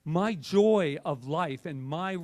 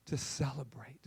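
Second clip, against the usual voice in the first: soft breathy whisper; 2 semitones lower, 10 dB quieter than usual. Real, not acted. Preacher hushing the room on "celebrate", whispered. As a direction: breathe the words out.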